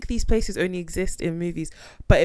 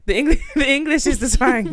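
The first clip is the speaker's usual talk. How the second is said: laughing through the line, higher and louder; 7 semitones higher, 6 dB louder than usual. Real, not acted. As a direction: burst out laughing as you say it. Laughing at her own joke.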